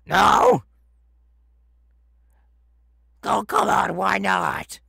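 loud high startled protest; outraged disbelief. Acted. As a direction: throw it back in disbelief. Refused, protests loudly.

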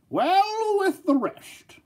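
high, slow, hammed-up herald tone; mock grand drawl. Vlogger comparing figures, hammed-up pompous herald voice.

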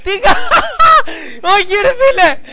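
high, loud, breaking into laughter; can't get the words out for laughing; real helpless laughter. Real, not acted. Cracking up at the clip.

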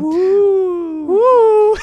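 high, drawn-out ghost howl; ghostly wooing. Pretend. Prankster haunting Ray Junior, high spooky wooing. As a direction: play a ghost with long high woos.

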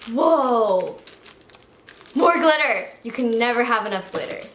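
normal talking voice

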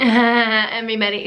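airheaded mock greeting through a laugh; lightly voiced ditzy influencer; mocking Lundy. Mocking the influencer's greeting, a hint of airhead voice. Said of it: "she literally sounds like she doesn't even have three brain cells".

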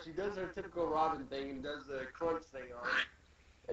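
ordinary delivery

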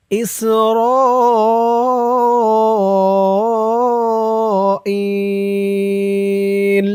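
إسرائيل with the final ي held very long; madd arid at stop. Shown as a demonstration. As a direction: stretch إسرائيل out at the pause. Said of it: "তিন আলিফ টান হয়ে যাবে".

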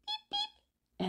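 very high, soft chirpy beeps; tiny chick beep. Acted. Chick answering its fathers from the egg, high soft peeps. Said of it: "answered the egg".

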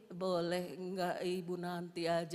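slow, low, feeble pleading; weak injured patient. Retold or imitated. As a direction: imitate a hurt patient asking softly for a delay. Injured person pleads to postpone talking. (menirukan pasien yang lemah memohon)

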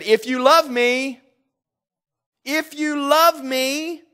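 high, loud pleading sermon voice; passionate preaching. Acted. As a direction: plead the verse to the congregation. Preacher voicing Jesus' command.

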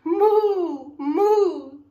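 loud, high drawn-out moo; cow moo. A narrator's reading. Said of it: "said the cow".

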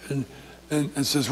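ordinary talk

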